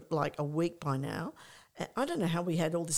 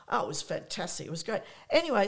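usual speaking voice

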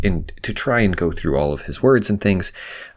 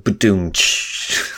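slow mouthed drum rimshot; ba-doom tsh like a comic's drummer; much slower than usual. Pretend. Rimshot after his own pun.

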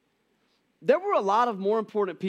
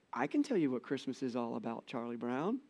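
a hint of calm Linus, lower and softer; 4 semitones lower, 8 dB quieter than usual. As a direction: gently answer like a steady cartoon boy. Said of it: "I always hear it in the voice of Linus".

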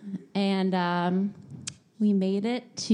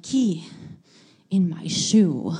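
put-on slow, louder, lightly accented deadpan; deadpan foreign woman; 2 semitones lower, 5 dB louder, slower than usual. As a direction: say it flatly like a strange stranger. Czech woman's odd answer.

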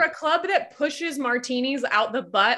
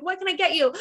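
higher-pitched customer-service lilt; server greeting; 6 semitones higher than usual. Retold or imitated. Waitress answering a table light, chirpy service voice. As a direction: lift it into a polite server's question. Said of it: "come over and be like".

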